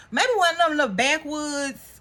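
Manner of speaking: usual speaking voice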